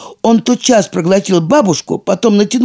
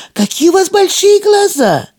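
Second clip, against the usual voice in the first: amazed. A narrator's reading. Girl amazed at grandma.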